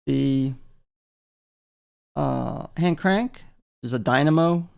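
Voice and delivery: ordinary delivery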